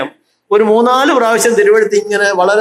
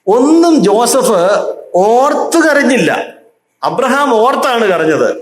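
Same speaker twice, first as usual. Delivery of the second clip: impassioned preaching; 6 semitones higher than usual. Acted. Preacher raises voice on point.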